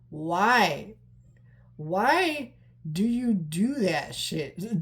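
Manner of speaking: slow, drawn-out exasperated plea. Acted. Moviegoer fed up with parents explaining everything, acted exasperation. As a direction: stretch each word in mock despair.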